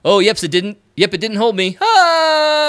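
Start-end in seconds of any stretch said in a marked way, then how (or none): 1.8-2.7 high mock scream; falling scream. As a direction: yelp as the rock gives.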